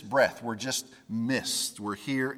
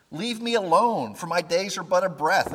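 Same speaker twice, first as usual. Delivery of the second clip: higher and louder, a pleading cry; anguished plea; 6 semitones higher, 5 dB louder than usual. Acted. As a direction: cry out to be left alone. Job's anguished lament quoted.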